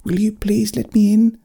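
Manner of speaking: very high little bear voice; tiny creature asking politely; little bear pleading. A narrator's reading. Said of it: "the little figure say through the glass".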